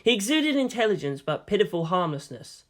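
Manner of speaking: ordinary delivery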